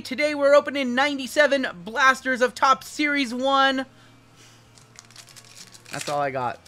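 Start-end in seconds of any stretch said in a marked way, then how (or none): 0.0-3.9 loud, high YouTube host intro; big, high-energy announcer impersonation; Jabs. Acted. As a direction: belt out the show opener to the viewers. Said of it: "Let me, let me try".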